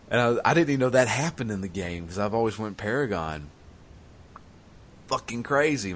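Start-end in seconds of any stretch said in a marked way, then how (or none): none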